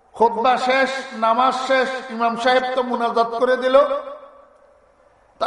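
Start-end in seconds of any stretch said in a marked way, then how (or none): none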